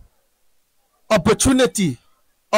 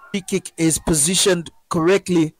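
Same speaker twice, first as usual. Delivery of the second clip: ordinary talk, nothing marked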